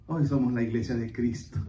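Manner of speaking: ordinary talk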